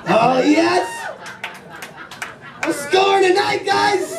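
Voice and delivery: high gleeful brag; high-pitched giddy boast. Real, not acted. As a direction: crow about scoring. Comic thrilled about a hug.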